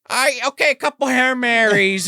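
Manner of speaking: high, loud, drawn-out penance; mock uneasy priest. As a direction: dole out penance loudly, backing off. Acts out priest hurriedly backing off.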